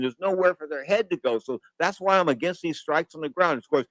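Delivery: normal talking voice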